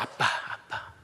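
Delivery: whispered 아빠, 아빠; a hushed intimate call; whispering. Shown as a demonstration. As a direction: breathe 아빠 twice, voiceless. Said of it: "soft repeat".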